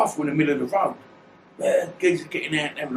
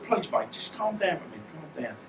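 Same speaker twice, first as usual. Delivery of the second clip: soft, much quieter plea fading out; pleading calm; 8 dB quieter than usual. Retold or imitated. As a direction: soothe him, barely above a murmur. Pleading angry man to calm.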